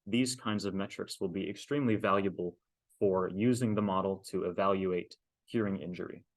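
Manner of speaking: ordinary delivery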